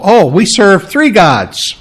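ordinary delivery

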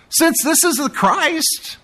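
high, slow sarcastic sneer; mocking. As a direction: stretch it out in scorn. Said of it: "Can you imagine the way they're saying this".

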